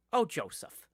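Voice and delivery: high, loud New Jersey aunt voice; Jersey aunt placating her brother, high and loud; aunt's New Jersey voice. Retold or imitated. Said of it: "a voice kinda like some of my aunts do".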